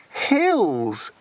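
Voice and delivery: high moaning complaint; moaned. A narrator's reading. Luciane tired of walking, moaning complaint. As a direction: moan it, worn out. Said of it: "moaned Luciane".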